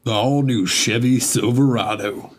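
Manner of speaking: mock commercial announcer voice; truck ad announcer. Streamer jokes in cowboy voice, mock ad announcer. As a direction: sell it like a TV truck ad. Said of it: "Sound like a Marlboro man".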